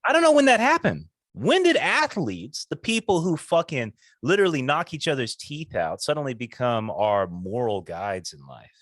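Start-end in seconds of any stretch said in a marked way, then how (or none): 0.1-5.4 loud, high, exasperated burst; outraged outburst. Real, not acted. Host fed up with preachy athletes, loud outburst. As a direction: blurt it out, fed up.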